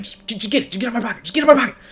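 badgering. Miles pestering his friend to grab the phone, badgering. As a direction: nag him to just grab it. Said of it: "you badgering him".

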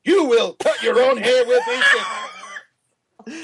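high, slow barked shout with an odd voice; drill sergeant. Retold or imitated. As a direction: bark it like a boot-camp sergeant. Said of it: "was she raised by a drill sergeant".

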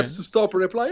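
ordinary talk, nothing marked

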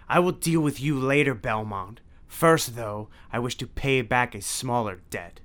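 cold promise of revenge; Dracula voice. A narrator's reading. Dracula threatening Belmont.

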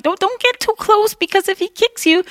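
high, squeaky voice of a nervous friend. Retold or imitated. Friend at a breaking battle warning her, in a high mock voice. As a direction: pitch way up to play the worried friend. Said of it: "they're like".

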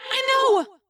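put-on high, distant, resigned girl; trapped Rachel. A narrator's reading. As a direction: accept it sadly in a high voice. Plays trapped Rachel in narration.

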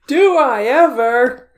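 slow, loud, high theatrical question; dramatic reply. Pretend. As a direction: ham it up like a drama line. Dramatic reply about Esperoba.

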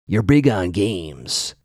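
slow, drawn-out tough-guy voice; Caleb. Retold or imitated. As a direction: play it tough, taking each word slowly. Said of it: "Caleb is being tough guy".

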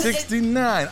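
high, drawn-out sixty-nine; emphatic. Acted. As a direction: savor the number with a grin. Host repeats number showily.

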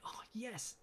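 high, light imitation of a thrilled son; delighted congratulation. Marty congratulates his dad, retold.

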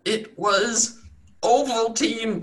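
high, drawn-out fake revelation; mock dramatic reveal. Game master teases players with her name, mock dramatic. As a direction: stretch out the name for a joke.

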